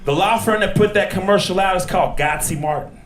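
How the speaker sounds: ordinary talk, nothing marked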